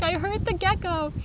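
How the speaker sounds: high, alarmed wail; upset that the gecko got hurt; mock panic. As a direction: whine it in fake horror. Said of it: "I'm, like, freaking out".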